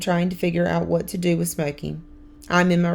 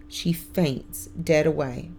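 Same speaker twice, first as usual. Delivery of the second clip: ordinary delivery, nothing marked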